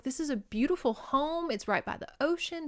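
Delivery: mildly acted gushing; delighted. She loves the seaside cottage, acted delight. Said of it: "and she loves it".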